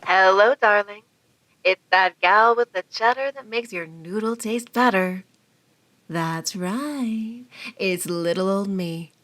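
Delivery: sultry vamp purring loud; a glamorous horror hostess purr; femme fatale. Acted. Vamp hostess answering phone.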